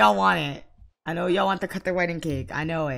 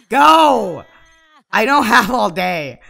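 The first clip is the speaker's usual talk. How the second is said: loud high command; impatient shout; 4 semitones higher, 11 dB louder, slower than usual. Real, not acted. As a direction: bark at them to hurry. Ordering Sims impatiently.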